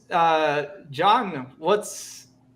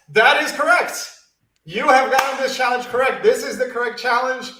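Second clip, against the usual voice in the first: loud, high-pitched winner announcement; excited announcement; 5 semitones higher, 5 dB louder than usual. Real, not acted. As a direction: proclaim the champion with rising excitement.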